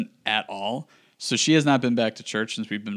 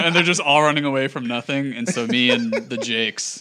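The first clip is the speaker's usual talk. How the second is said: ordinary delivery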